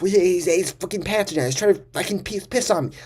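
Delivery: high, frantic mock scream; retold screaming. Kid screaming to a teacher about a friend, high panicked yell. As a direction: blurt it out in a high-pitched panic. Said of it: "So I start screaming".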